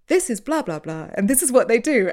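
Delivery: high, loud announcer's recap; mock podcast presenter. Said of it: "the presenter came in and was like".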